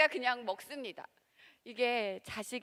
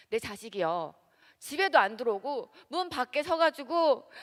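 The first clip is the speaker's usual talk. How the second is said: usual speaking voice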